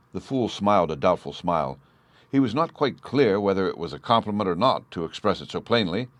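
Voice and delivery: ordinary delivery, nothing marked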